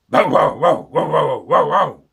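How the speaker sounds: loud barks; dog barking. Acted. Bitzer Maloney barks, very loud. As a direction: bark like a big dog. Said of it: "said Bitzer Maloney".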